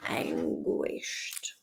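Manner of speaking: unvoiced whisper to oneself. Real, not acted. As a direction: whisper the word under your breath. Muttering the word while working.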